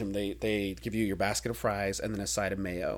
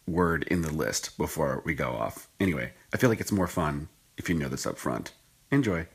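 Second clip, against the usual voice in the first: low, measured studio insert; editor's voice-over aside; 5 semitones lower than usual. Real, not acted. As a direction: chime in low and deliberately from the editing room.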